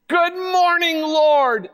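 cheerful greeting. Acted. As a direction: greet the day with delight. Cheerful morning greeting acted out.

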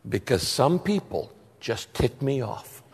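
low, quiet God voice, dry; a hint of a grumpy God. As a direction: deliver it as a deadpan God from above. Joke punchline, God's voice.

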